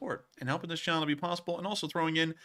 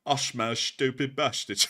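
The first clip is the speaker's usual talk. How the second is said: ordinary delivery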